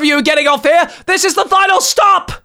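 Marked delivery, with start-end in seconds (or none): 1.1-2.3 high, strained screech. Real, not acted. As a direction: shriek it like you've had enough. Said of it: "screeching about it".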